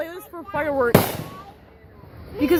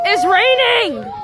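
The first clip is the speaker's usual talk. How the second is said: excited shout; 6 semitones higher, 6 dB louder, slower than usual. Real, not acted. Fireworks debris falling, excited.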